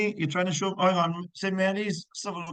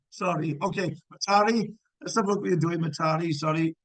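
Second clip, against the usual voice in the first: normal talking voice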